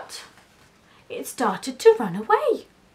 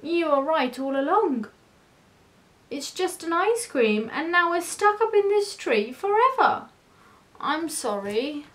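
higher plaintive confession, slowing at the apology; sad admission; 6 semitones higher than usual. A narrator's reading. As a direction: own up and apologise ruefully. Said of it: "he admitted sadly".

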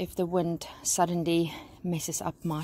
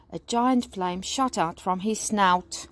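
usual speaking voice

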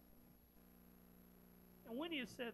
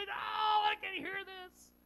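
high, mock-outraged shout; loud crying out; 10 semitones higher, 8 dB louder, slower than usual. Preacher voicing the crowd who stopped their ears, loud mock outcry. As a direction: cry out loudly to drown him out. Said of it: "cried out with a loud voice".

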